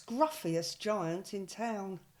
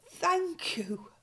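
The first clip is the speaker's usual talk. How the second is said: high, bright giraffe thanks; a happy lilt of thanks; grateful giraffe; 7 semitones higher than usual. Acted. Giraffe thanks George for the scarf, high and pleased.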